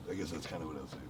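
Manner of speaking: ordinary delivery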